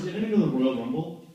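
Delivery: ordinary talk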